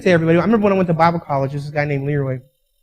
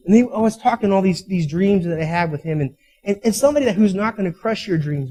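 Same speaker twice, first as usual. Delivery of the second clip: ordinary talk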